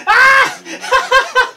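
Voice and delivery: high, slow laughing shriek; mock shriek. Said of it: "He's like".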